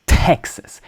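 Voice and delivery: Texas with a puff of air after T; hard English T. Shown as a demonstration. Teacher shows English consonants, hard T in Texas. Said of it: "consonant sounds much harder than French".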